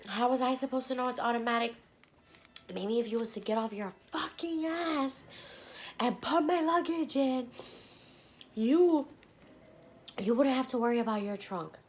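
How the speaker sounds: high, indignant telling-off; retold going off. Tired passenger tells off cab driver over luggage, going off indignantly. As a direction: re-enact ranting back, pitch climbing through it. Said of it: "I'm going off".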